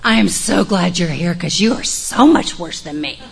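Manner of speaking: loud, gushing mock of a dramatic friend. Retelling Kim greeting Joe at the meeting, dramatic gush. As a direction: overplay her relief with a laugh. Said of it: "she's very dramatic".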